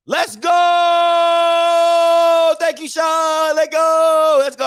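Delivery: high, loud hype yell; excited shout. Real, not acted. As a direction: yell it like game day hype. Excited shout for Cowboys game.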